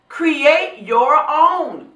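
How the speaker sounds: slow, weighty "Create your own."; quoting Tyler Perry slowly. Retold or imitated. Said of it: "He said on several different occasions".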